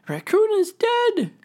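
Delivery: high, loud squeaky creature voice; little crayfish voice. Acted. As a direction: announce it in a tiny high voice. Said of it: "said the small crayfish".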